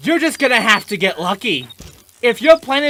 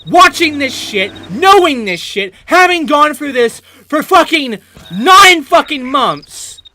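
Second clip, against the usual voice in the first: usual speaking voice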